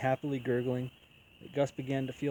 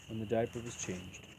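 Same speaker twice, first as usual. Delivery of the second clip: ordinary delivery, nothing marked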